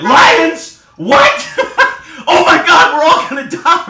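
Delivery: shrieking high and loud in mock terror; hammed-up freak-out, way up high; mock panic. Tour guide panicking at lions, hammed-up high shriek. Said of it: "the tour guide went".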